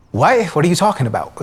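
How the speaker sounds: high, loud stunned outburst; shocked disbelief. Retold or imitated. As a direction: react to sudden news of a death. Hearing father died.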